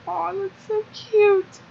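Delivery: high, slow cooing; drawn-out high-pitched aww. Real, not acted. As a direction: melt over it like watching a puppy.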